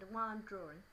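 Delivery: plain reading voice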